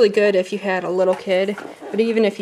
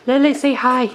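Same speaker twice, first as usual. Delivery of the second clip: louder, higher call to a pet; calling the dog; 4 semitones higher, 7 dB louder than usual. Real, not acted.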